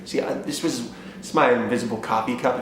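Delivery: ordinary talk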